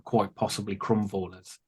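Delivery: ordinary talk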